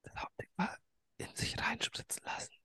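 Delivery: hushed whisper. Real, not acted. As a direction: whisper so no one wakes up.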